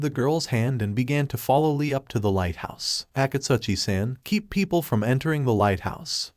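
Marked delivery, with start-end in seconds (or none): none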